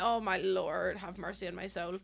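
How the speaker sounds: soft, low mock plea; mock prayer. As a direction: murmur it like a prayer. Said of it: "I'm like".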